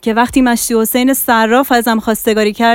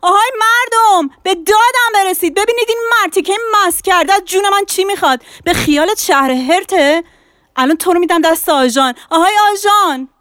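high, fast, shrill-edged street quarrel; wife shouts back at her husband, high and fast; loud retort; 13 semitones higher than usual. A narrator's reading. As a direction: scream for help and shame him. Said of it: "زن ایستاد و بلند گفت".

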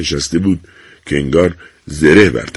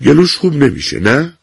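firm tone; 9 semitones higher than usual. A narrator's reading. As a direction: state it firmly, closing the matter. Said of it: "با صدایی بلند و لحنی قاطع".

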